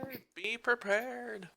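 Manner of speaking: slow, hushed and high; ominous echo. Acted. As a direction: drawl it out like a spooky echo. NPC echoing speaker dramatically.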